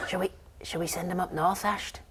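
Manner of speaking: a hint of a plotting pixie; sly fairy whisperer. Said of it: "you can hear one of them say".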